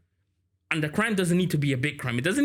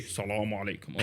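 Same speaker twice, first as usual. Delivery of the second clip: lower, soft salaam with a different voice; another greeting variation; 9 semitones lower, 7 dB quieter than usual. Retold or imitated. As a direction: give the salaam a different low voice. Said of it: "different variations of salam".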